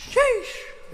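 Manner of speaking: very high-pitched squeal; squealed exclamation. Real, not acted. Exclaims at a near miss.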